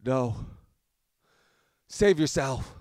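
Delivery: loud, high heroic dying plea; overacted death scene. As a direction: ham up a noble last sacrifice. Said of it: "I'll go".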